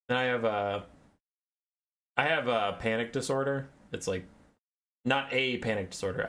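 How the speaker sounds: ordinary delivery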